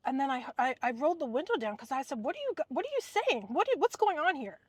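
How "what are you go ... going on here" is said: much higher, incredulous protest; indignant retort. Retold or imitated. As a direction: throw it back at them in disbelief, pitched up. Retorting to the gossiping neighbors.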